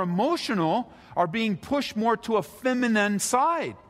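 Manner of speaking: high-pitched, insistent sermon delivery; impassioned preaching. Real, not acted. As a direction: preach it with rising intensity, pitch climbing. Preaches at raised intensity.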